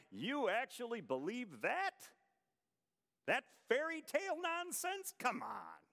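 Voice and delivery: high-pitched incredulous scoff; mocking disbelief. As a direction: imitate a mocker ridiculing a believer. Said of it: "people who laugh and mock".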